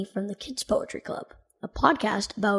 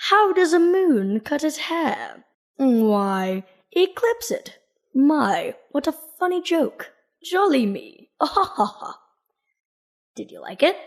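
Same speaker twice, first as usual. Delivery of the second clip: hammed-up British accent, higher and slow, jolly; mock British accent; 4 semitones higher, slower than usual. Telling Darren a dad joke in a put-on British accent. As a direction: play a posh Brit amused by his own pun in a British accent. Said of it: "I will do my best British accent".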